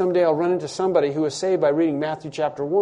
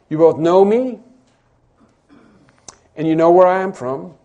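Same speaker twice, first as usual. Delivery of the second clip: louder, emphatic words of Jesus; intensified quote; 3 semitones higher, 8 dB louder than usual. Shown as a demonstration. Preacher voicing Jesus to the crowd, loud and emphatic. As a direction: proclaim it with full force. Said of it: "he uses that intensified".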